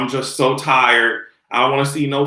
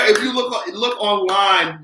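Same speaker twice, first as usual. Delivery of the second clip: normal talking voice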